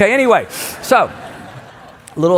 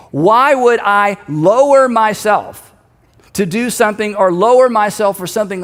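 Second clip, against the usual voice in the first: emphatic challenge; 3 semitones higher, 5 dB louder than usual. Acted. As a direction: refuse with stretched, forceful words. Acting out Nehemiah's challenge.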